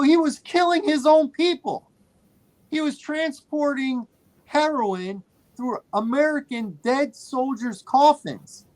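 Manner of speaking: high-pitched retold tirade; indignant protest. Moviegoer scolding women who clapped for a criminal, indignant. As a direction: spell out the outrage, voice held high. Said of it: "I was getting louder and more serious".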